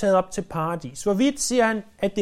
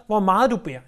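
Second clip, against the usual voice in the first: full-force preaching. Acted. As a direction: drive the point home to the congregation.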